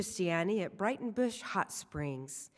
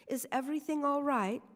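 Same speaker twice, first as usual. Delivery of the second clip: put-on French accent; 7 semitones higher than usual. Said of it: "said in an exotic French accent".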